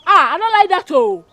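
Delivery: very loud, high-pitched scolding; angry shouting. Acted. Scolding a troublemaker to make them leave, angry shouting. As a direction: yell it in exasperation.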